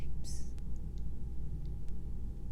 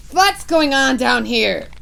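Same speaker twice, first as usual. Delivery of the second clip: louder commanding shout; 5 semitones higher, 9 dB louder than usual. Acted. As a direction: shout down from the platform. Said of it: "shouted the Grand High Witch".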